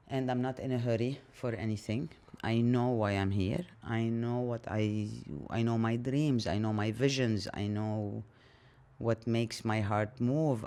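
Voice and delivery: ordinary talk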